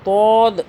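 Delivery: دود loud, with a heavy rounded D; thick dwad. Shown as a demonstration. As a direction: round the mouth inside for a full, heavy دود. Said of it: "دود کو موٹا پڑھنا ہے". (دود موٹا اور گول)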